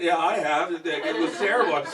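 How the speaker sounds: normal talking voice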